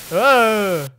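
loud cry of panic; acted scream. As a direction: scream out mid-plea. Acted scream in the scare scene.

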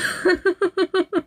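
high breathy giggle; soft high laugh. Real, not acted. Laughing at own story.

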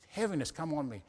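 ordinary delivery

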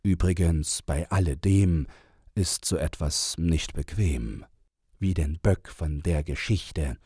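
ordinary delivery

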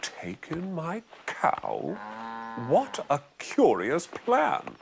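put-on high, slow puzzled old woman; old lady voice. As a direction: query it like a bemused old lady.

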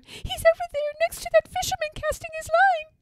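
very high, squeaky bird voice; piping storybook sparrow; crying sparrows. A narrator's reading. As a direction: point him out as an eager little bird. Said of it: "cry all the little sparrows".

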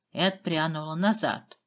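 ordinary delivery

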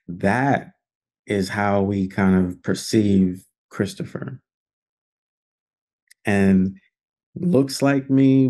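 usual speaking voice

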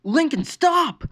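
high, slow, loud desperate plea; begging. A narrator's reading. Sister begging her brother to stop, desperate. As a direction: beg him to stop. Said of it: "Lori begged him".

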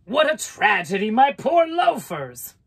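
high, loud squeal of dismay. A narrator's reading. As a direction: wail it like a vain pig. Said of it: "squealed the pig".

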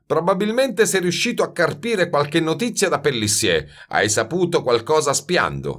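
mock him, then accuse him; jeering. A narrator's reading. Baldini accuses Grenouille of being a cheat, jeering. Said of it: "Lo schernì Baldini".

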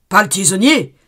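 « tisonnier » crié plus fort, en descendant; répète « pas le tisonnier » en criant; cri suppliant. Acted. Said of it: "répétait l'abbé". (crié en suppliant)

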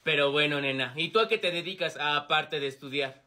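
very low smooth-talker voice; flirting. Acted. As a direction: drop the pitch low to chat her up.

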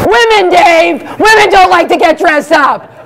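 mock outrage at the host; comic rant. Real, not acted. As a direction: fire it back at Dave. Ranting back at host Dave.

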